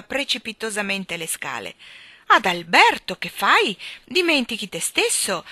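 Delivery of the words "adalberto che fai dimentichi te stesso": loud, indignant scolding; queen shouting angrily at her husband; furious shout. A narrator's reading. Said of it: "gridò infuriata la regina".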